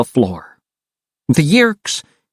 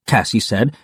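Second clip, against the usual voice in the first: ordinary delivery, nothing marked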